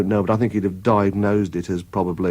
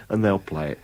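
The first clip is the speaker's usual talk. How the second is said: ordinary delivery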